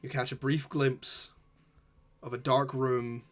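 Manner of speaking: slow, hushed scene-setting narration; game master narration. Acted. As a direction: draw the players into the scene slowly.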